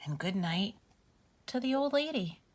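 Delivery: gentle sing-song bedtime read-aloud; reading to children. Acted. Reads bedtime story to children.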